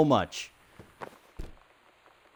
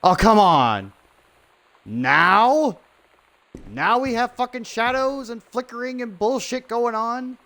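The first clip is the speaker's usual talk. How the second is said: loud, higher, exasperated yelling at the screen; frustrated outburst; 9 semitones higher, 3 dB louder than usual. Real, not acted. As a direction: blow up at the game. Streamer raging at game glitch.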